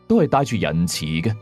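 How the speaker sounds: ordinary delivery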